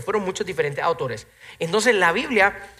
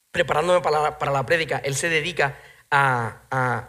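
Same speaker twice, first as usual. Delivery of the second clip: ordinary talk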